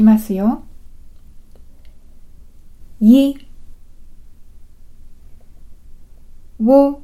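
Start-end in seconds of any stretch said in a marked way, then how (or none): 3.0-7.0 イ and ウ each said alone, higher and crisp; careful vowel demo. Shown as a demonstration. Teacher repeats イ and ウ for learners, higher and distinct. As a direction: model イ, then ウ, slowly and distinctly. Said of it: "イとウだけもう一度発音してみましょう".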